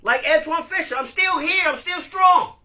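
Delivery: high, loud defiant declaration; Antwone Fisher quote. Retold or imitated. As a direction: shout it proudly like a survivor. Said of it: "I love that movie".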